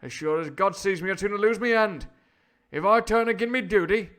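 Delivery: put-on high, loud, impassioned old-film sailor; hammed-up indignant sailor; defiant honest sailor. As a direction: declaim it as a loyal seaman standing firm. Voices the sailor in story.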